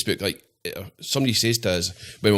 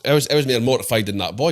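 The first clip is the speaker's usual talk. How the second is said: usual speaking voice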